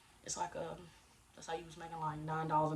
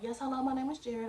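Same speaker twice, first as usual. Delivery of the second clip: higher, louder polite phone voice; customer service voice; 6 semitones higher, 5 dB louder than usual. Shown as a demonstration. Applicant introducing himself to the manager, customer service voice. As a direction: switch into your customer service voice. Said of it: "you put your customer service voice on".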